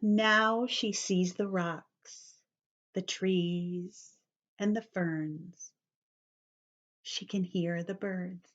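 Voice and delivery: ordinary delivery, nothing marked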